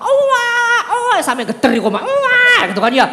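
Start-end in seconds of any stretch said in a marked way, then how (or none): 0.0-1.2 imitated baby wail. Baby wailing with full effort for milk, mock wail. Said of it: "saya nangis sekuat tenaga". 2.0-2.6 high, loud mock baby wail; imitated baby wail. Baby wailing with full effort for milk, mock wail. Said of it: "nangis sekuat tenaga".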